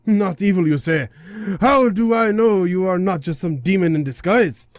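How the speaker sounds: scolding, affronted deity voice; indignant guardian god. Acted. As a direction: berate an intruder like an offended god. God dismisses the spirit.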